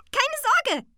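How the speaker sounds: very high, loud creature giggle; giggle, then squeak the reassurance; giggling. A narrator's reading. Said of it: "kicherte das Tingeli". (kichernde hohe Piepsstimme)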